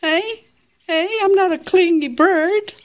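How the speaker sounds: high, playful put-on bird voice; pet bird's protest. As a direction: protest as the pet, pitch climbing and drawn out. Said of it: "that's what your way of saying".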